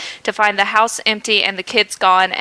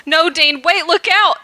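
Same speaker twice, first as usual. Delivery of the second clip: higher, urgent cry of warning; howled warning; 7 semitones higher than usual. A narrator's reading. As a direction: yell it as the car comes. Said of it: "Sam howled".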